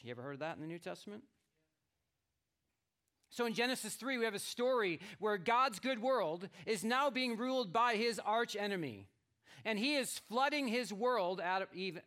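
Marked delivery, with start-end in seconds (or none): 3.3-12.0 raised, louder preaching voice. Acted. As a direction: proclaim the story to the congregation. Preaches on the arch enemy.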